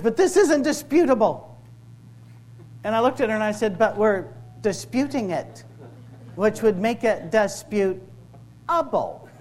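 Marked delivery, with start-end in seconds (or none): 0.0-1.5 high, loud indignant protest; mock outraged objection; angry woman. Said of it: "who was very angry at me". 3.8-9.3 slow, deliberately spelled-out comeback; drawn-out, pedantic correction; pointed retort. Retold or imitated. Spelling out his retort to her.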